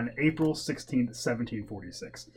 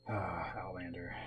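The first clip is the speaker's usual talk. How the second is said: whispered; 5 semitones lower, 9 dB quieter than usual. Real, not acted. Dreamy sigh over a show.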